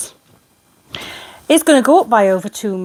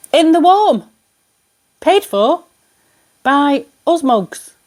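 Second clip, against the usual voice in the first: bitter sarcasm; 7 semitones higher, 5 dB louder, slower than usual. Real, not acted. Ranting about lords' perks.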